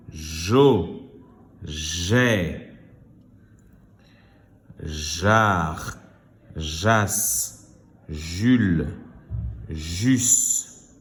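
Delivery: drawn-out syllables, one by one; syllable reading. Shown as a demonstration. Teacher reading syllables to pupils, slow and separated. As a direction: sound out each syllable separately with pauses. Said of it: "Je lis des syllabes".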